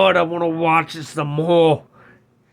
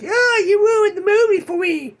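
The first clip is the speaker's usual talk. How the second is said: much higher, mocking whine; whiny complainer; 3 dB louder than usual. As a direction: mimic an annoyed fan griping. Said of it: "Don't try to do one of those".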